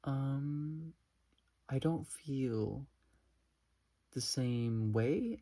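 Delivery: slow, drawn-out confused reply. Retold or imitated. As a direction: imitate a puzzled, hesitant answer. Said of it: "he looked at me confused".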